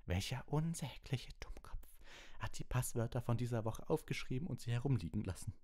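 high, whispery start into angry demand; trembling fury. A narrator's reading. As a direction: demand the culprit with trembling anger. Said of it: "sagte sie mit zitternder Stimme".

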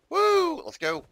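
high whooping cheer. Real, not acted. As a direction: let out a triumphant whoop. Cheering an escape in game.

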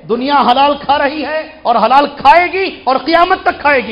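defiant preaching. Real, not acted. Preacher defiantly answers opponents.

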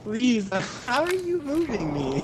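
high, laughing disbelief; laughing plea. Real, not acted. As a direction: laugh through the words. Laughing while pleading.

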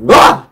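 short, startled yelp; real burst of fright; sudden scream. Real, not acted. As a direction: let out a quick involuntary scream. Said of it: "I shouldn't have screamed".

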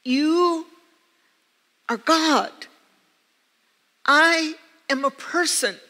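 to Jesus, protesting she is only human; pleading protest. Retold or imitated. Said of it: "And I said".

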